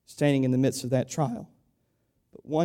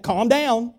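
telling you calm down; 11 semitones higher, 6 dB louder than usual. Retold or imitated. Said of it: "You ever had somebody tell you that?".

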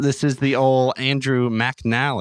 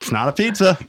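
mock outrage; 6 semitones higher than usual. Mock outrage about deep dish.

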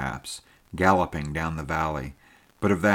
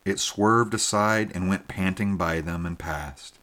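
usual speaking voice

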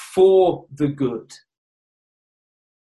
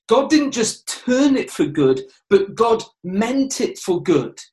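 ordinary talk

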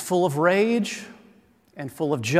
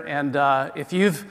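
ordinary talk

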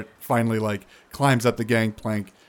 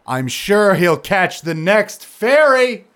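high, loud, slow drawn-out ferry; exasperated; 8 semitones higher, 7 dB louder, slower than usual. Acted. Tavar dismissing Zandros, exasperated.

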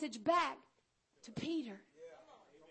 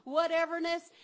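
louder, higher, building preaching; full-force preaching; 4 semitones higher, 6 dB louder than usual. Real, not acted. Preacher rousing the congregation about being chosen, louder fervent preaching. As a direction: preach it out with fire.

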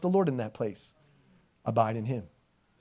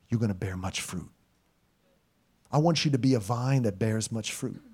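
lower, softer, tender fatherly voice; 7 semitones lower, 3 dB quieter than usual. Acted. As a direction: speak as God gently consoling. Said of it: "He looks at you and he says".

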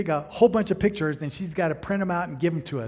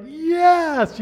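excited; 10 semitones higher, 6 dB louder than usual. Retold or imitated. Relieved doctor will see them.

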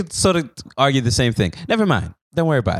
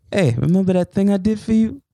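higher-pitched Italian mobster calling in a favor; Italian mafia accent; 8 semitones higher than usual. Acted. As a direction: put on a wheedling Italian-American accent. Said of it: "Italian voice".

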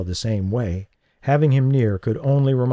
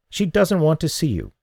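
hard voice; 7 semitones higher than usual. A narrator's reading. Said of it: "the hard voice repeated".

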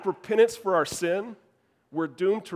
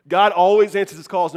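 louder, driving the point home; repeated louder as the sermon's key line; emphatic repeat; 2 semitones higher, 8 dB louder than usual. Shown as a demonstration. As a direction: proclaim it to the room, much louder.

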